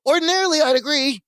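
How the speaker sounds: high, hurried cut-in; Larry's hasty interjection. Retold or imitated. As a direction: jump in fast and high to stop them. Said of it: "Larry immediately goes".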